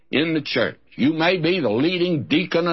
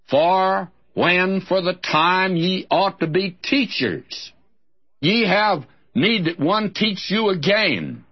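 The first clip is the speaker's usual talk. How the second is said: higher, measured Bible reading; scripture reading; 5 semitones higher than usual. Acted. Preacher reading Hebrews to his listeners. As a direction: read the verse slowly and solemnly.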